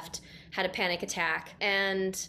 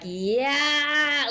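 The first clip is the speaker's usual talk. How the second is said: long, raised, wailing cry; demonstrated howl; 6 semitones higher, 4 dB louder than usual. Shown as a demonstration. Speaker showing sounds from the ugly cry, imitated howl. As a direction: imitate a primal howl, held out. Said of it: "not yelling, but, like, this".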